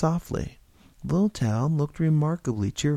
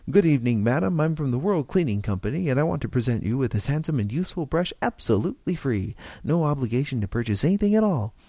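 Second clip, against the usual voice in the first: quick, bright doorstep pitch; fast sales pitch; 3 semitones higher, faster than usual. Shown as a demonstration. Stranger demonstrates the pitch to George, fast salesman patter. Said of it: "give her this and then talk fast".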